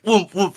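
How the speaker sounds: loud booming woomph sounds; mock coffin thuds; coffin thud noise. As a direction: thump it out like a heavy coffin hopping.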